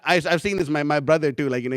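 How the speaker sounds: ordinary talk, nothing marked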